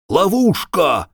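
high, slow alarmed shout; sudden cry. Acted. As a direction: cry out a warning. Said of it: "вскрикнул Браги".

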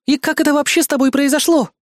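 high, aghast woman's voice; horrified. A narrator's reading. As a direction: gasp it out in dismay. Horrified woman questions the boy.

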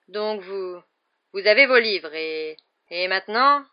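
halting, with stalls and repeats; losing the thread. A narrator's reading. Hagrid teaching after losing the thread, halting. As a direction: grope for the next words. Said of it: "qui semblait avoir perdu le fil".

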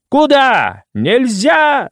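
high, shrill wailing shout; shrill yelling women. Acted. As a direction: yell it at him in outrage. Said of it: "заголосили обе тётки".

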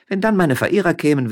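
high, lively miller's wife; Frasquita voice. A narrator's reading. Frasquita teasing her husband up in the vine, high. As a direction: pitch up, playful and teasing.

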